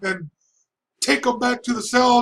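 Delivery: high commanding judge voice; angry judge. Retold or imitated. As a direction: bark the order like the furious judge. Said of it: "he goes".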